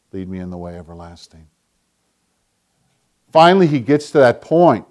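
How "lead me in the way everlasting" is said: barely above a breath, softer and lower; near-whisper echo. Acted. Preacher echoing the verse as his own prayer, near-whispered. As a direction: repeat it as a private prayer.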